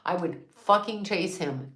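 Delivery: normal talking voice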